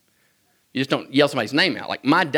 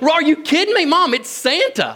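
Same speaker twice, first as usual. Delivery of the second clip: put-on much higher, louder thrilled little boy; excited kid; 12 semitones higher, 3 dB louder than usual. Little boy calling to his mom about Santa, high thrilled squeal. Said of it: "I'm like".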